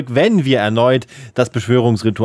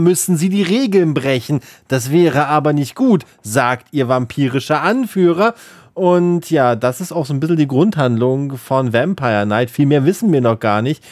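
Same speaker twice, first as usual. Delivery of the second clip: usual speaking voice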